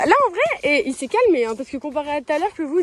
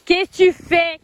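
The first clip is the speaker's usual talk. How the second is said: loud, high scolding; scolding shout; 5 semitones higher, 9 dB louder, slower than usual. Real, not acted. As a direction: call out sharply at the horse. Scolding the horse.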